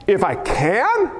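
"can" stretched out, rising in disbelief; emphatic echo. Acted. Jesus answering the father's doubt, emphatic on "can". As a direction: throw "can" back slowly as a challenge.

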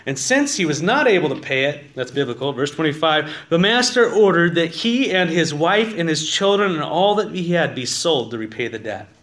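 loud, driving reading of the parable; forceful scripture reading. Acted. Pastor reads the parable to his congregation, loud preaching. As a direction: read it out like you're preaching to the back row.

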